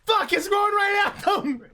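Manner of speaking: high, slow alarmed shout; real alarm; surprised. Real, not acted. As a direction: caught off guard as it turns. Game goes wrong.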